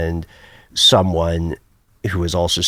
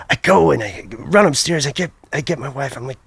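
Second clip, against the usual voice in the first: ordinary talk, nothing marked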